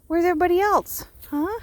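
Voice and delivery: high, loud call to the flock. Real, not acted. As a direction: call out high for the missing sheep. Calling high to sheep.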